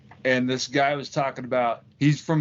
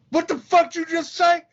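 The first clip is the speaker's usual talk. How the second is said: mock outrage, high and loud; outraged challenge; 13 semitones higher, 5 dB louder than usual. America reacting to an insult, mock outraged challenge. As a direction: jump on it like picking a fight. Acts out America taking offence.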